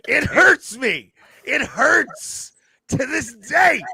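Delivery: drawn-out, high-pitched lament; anguished wailing. Real, not acted. Lamenting the lost autographed jersey to co-host, anguished wail. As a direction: wail it loudly, stretching each word.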